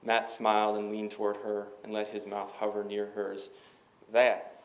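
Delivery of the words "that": loud, lightly put-on Southern drawl. A narrator's reading. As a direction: hit it loudly with a touch of Southern drawl. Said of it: "in his own drawl".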